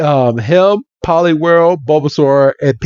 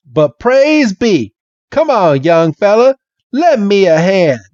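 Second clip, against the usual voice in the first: hammed-up Southern old-timer phrasing; Bill; 7 semitones higher than usual. Bill asking the young fella for help, Southern accent. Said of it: "this Southern accent".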